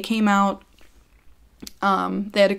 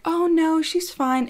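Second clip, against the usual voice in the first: higher-pitched mock brush-off; mock dismissive; 9 semitones higher, slower than usual. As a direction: airily wave it away, imitating the neighbor. Retold dismissal by neighbor.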